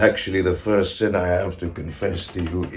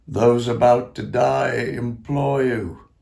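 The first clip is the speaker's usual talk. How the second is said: slow, louder entreaty; imploring; 3 semitones higher, 5 dB louder than usual. Acted. Dying man implores the ghosts, slow ringing plea. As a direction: draw it out as a strong appeal.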